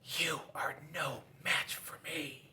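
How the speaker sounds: whispered menacing taunt; hissed whisper taunt. Acted. Scissors taunts defeated Paper, whispered menace.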